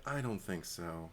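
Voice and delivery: softly, slowly letting him down; quiet and kind, but firm; gentle turn down. Retold or imitated. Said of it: "she's just kinda like".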